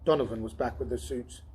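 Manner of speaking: ordinary delivery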